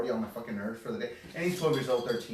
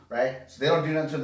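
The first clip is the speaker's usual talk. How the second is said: normal talking voice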